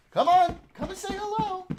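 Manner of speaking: sing-song high call to the dog; high-pitched doggy call; high pet-calling voice. Real, not acted. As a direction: coax the puppy over, high and bright. Coaxing the dog.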